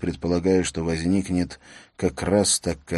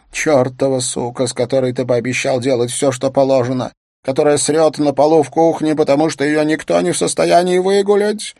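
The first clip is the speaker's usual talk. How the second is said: furious, rising higher and louder; angry shouting; 7 semitones higher, 7 dB louder than usual. Acted. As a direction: yell at him in fury. Wife yelling at husband.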